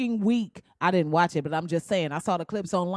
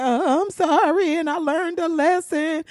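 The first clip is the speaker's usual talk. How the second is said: mock apology; 7 semitones higher, 4 dB louder than usual. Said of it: "they be like".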